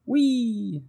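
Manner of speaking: high, gleeful squeal of a wound-up little bird; excited Bird. Acted. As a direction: squeal it like a bird who won't settle. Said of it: "said Bird".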